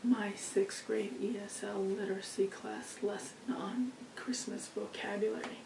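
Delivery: hoarse, whispery lost voice; straining through a lost voice. Real, not acted. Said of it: "I lost my voice today".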